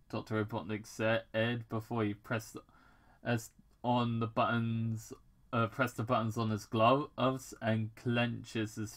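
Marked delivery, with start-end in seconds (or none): none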